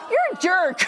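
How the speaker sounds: high, loud laughing you're a jerk; laughing insult. Retold or imitated. To Kevin after the prank, laughing you're a jerk. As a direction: tease him while laughing.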